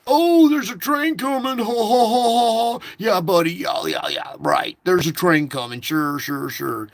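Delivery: high, loud sneering mockery with fake laughs; mock the warning like a smug jerk; mocking driver. Driver in the car mocking the warning, sneering and laughing. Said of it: "And he'll mock me".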